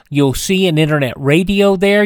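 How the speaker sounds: ordinary delivery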